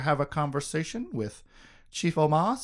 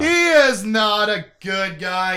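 loud, high, drawn-out insistence; slow, exasperated shout; emphatic outburst; 6 semitones higher, 7 dB louder, slower than usual. Acted. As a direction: hammer every word at full voice. Emphatic repeat after co-host agrees.

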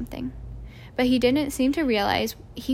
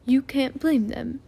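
gentle; slower than usual. A narrator's reading. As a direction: ease her worry kindly. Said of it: "said Dumbledore gently".

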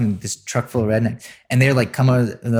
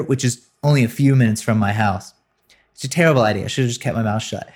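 ordinary talk

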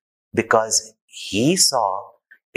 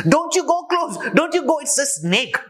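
high, panicked shrieking wife; mock scream of the wife. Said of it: "she screams it at him".

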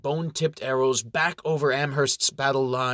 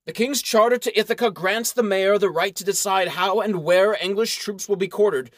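stately official; 7 semitones higher than usual. Acted. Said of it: "The man replies".

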